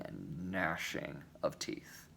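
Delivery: slow, breathy whisper; hushed whisper. Acted. Preacher describing outer darkness, dropping to a slow whisper. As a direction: let it fall away into a whisper, slowly.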